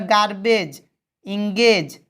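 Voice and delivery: "गार्बेज, इंगेज" with hard g inside, j at the end; final J sound. Shown as a demonstration. Teacher showing the GE rule, "गार्बेज, इंगेज" ending on j. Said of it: "GE है इसीलिए उच्चारण क्या होगा".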